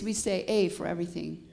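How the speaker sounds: normal talking voice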